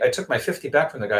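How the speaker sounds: normal talking voice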